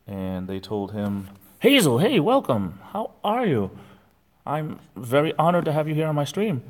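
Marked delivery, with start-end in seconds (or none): 1.6-6.6 high, loud gushing welcome; mocking a YouTuber greeting a donor; imitating the Carpetbagger. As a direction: ham up a fawning streamer greeting.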